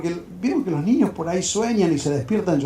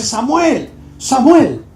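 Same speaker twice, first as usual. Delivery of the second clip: raised, louder call of a name; 5 semitones higher, 6 dB louder, much slower than usual. Acted. A voice wakes the sleeping boy, calling out.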